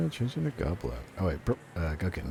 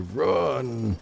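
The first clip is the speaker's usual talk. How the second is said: higher, drawn-out playful cry; urgent call to run; 8 semitones higher than usual. Pretend. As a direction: call it out high, as if fleeing in a game. Urges escape from explosion in game.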